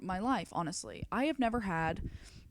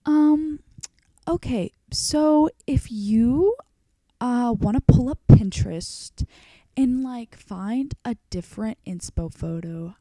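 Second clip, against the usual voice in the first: raised, loud, drawn-out hedging; stylist breaking bad news; 5 semitones higher, 8 dB louder, slower than usual. Stylist backing out to her client, imitated awkward hedging. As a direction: imitate a stylist stalling before a refusal. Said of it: "She looks at me and she goes".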